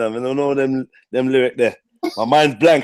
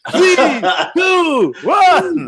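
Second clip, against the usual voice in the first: countdown shout; 12 semitones higher, 3 dB louder, much slower than usual. Pretend. Countdown like Gladiators start.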